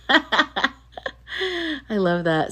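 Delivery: giggling delight; slow laughing aside. Real, not acted. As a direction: laugh through the words. Laughing reaction to comment.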